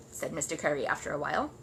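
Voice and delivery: usual speaking voice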